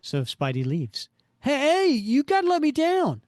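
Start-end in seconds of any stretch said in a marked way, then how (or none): 1.4-3.2 high, loud cartoon yell; frantic calling out. Stuck crook calls after the departing hero, frantic. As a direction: holler after him, pitched way up.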